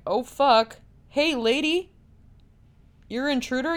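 a jolt of alarm, voice jumping up on "Hey, lady"; startled prisoner. Retold or imitated. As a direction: caught off guard in the dark, suddenly calling out.

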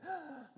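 high, sharp intake of breath; mock shocked gasp. Grandmother's friend mishearing Judas, mock horrified gasp. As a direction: gasp as if scandalised.

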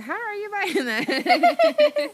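high, loud, drawn-out disbelief with laughter; mock incredulous outburst. Daughter retelling her shock at mom buying things on a trip, incredulous. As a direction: exclaim it high and slow, half laughing.

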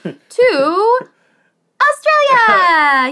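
high, drawn-out cheer of Australia; mock triumphant reveal. Host reveals the convicts' destination with a drawn-out mock cheer. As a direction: announce it like a grand prize, stretching the word.

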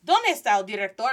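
loud demand of an irate parent; angry mama. Acted. Mama barging into the school to find the principal, angry. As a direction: storm in demanding the person in charge.

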